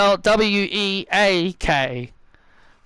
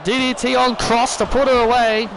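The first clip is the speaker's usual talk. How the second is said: normal talking voice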